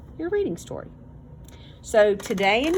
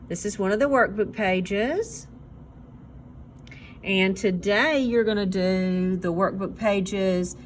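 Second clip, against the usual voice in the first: ordinary talk, nothing marked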